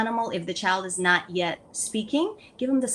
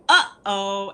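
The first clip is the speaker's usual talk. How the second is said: put-on a louder, stretched "Uh-oh" for a child; accentuated uh-oh; 6 dB louder than usual. Shown as a demonstration. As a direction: lean hard into the "Uh-oh" while playing. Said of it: "and you accentuate the sound".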